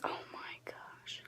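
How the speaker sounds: breathless whispered gasp of disbelief; shocked whisper. Real, not acted. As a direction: whisper it, stunned. Shocked aside after reading quote.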